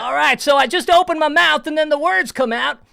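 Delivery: high, loud southern put-on voice; southern accent. Shown as a demonstration. Host teaching his trick to the caller, southern accent. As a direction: explain it in a big southern accent. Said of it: "the southern accent".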